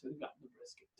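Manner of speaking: breathy whisper of the punchline. Retold or imitated. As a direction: drop to a whisper for the payoff.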